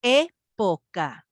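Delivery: slow teacher's breakdown of Época with pauses between syllables. Shown as a demonstration. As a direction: pull Época apart, one syllable at a time.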